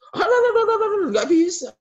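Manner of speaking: loud high scoffing alah; mocking dismissal. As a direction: brush it off in a shrill mock scoff. (ejekan meremehkan, keras dan tinggi)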